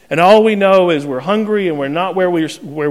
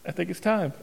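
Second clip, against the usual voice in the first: quiet, unhurried; a calm wife announcing labor, softly; calm, polite; 9 dB quieter than usual. Retold or imitated. Wife telling husband it's time, quietly and calmly. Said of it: "very politely said".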